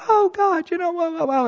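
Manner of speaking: whiny voice. Retold or imitated. Preacher mocking how we whine at God, whiny. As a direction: whine at God like a sulking child. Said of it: "our little whiny voice with God".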